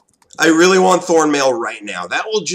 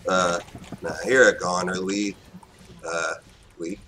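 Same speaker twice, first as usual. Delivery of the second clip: usual speaking voice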